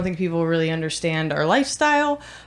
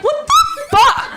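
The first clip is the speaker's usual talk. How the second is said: high, loud, drawn-out disbelief; outraged shout; 6 dB louder, much slower than usual. Acted. Reacting to stranger's talk of dead mothers, incredulous shout. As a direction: explode in hammed-up exasperation, stretching each word.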